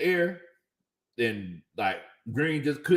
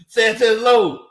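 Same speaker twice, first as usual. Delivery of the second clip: announcing a name; 6 semitones higher, 7 dB louder, slower than usual. Real, not acted. Calling out a donor's name.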